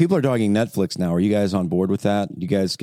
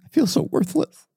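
fake crying. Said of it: "You're so bad at fake crying".

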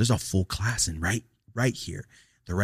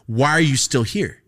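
louder, slow and pointed; irritated demand; 5 semitones higher, 7 dB louder than usual. Retold or imitated. Teacher to the student unplugging his chip, a slow annoyed demand. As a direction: drag it out like a fed-up teacher. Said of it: "Just like that".

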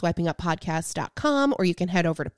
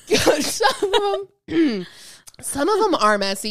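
laughing; 8 semitones higher, 5 dB louder than usual. Real, not acted. Repeating joke while laughing.

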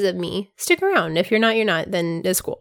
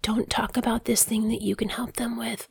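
whispering; 8 dB quieter than usual. Retold or imitated. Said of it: "So it's hard for me to be like".

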